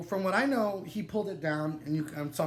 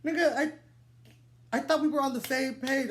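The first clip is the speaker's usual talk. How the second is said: higher, stunned disbelief; incredulous; 7 semitones higher than usual. Retold or imitated. Telling the man he thought they were on the same page, stunned. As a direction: plead in shock that he thought they agreed. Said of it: "I'm like".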